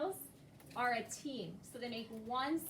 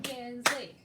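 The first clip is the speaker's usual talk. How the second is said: KINSLEY louder, split into KINS and LEY; clapped syllables; 5 dB louder than usual. Shown as a demonstration. Teacher clapping out a second grader's name, clapped syllables. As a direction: punch out KINSLEY in two beats. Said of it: "and clap it".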